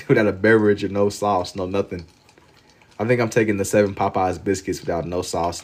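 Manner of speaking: ordinary delivery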